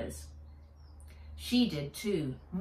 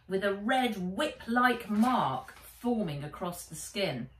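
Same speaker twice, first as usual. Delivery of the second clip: ordinary delivery, nothing marked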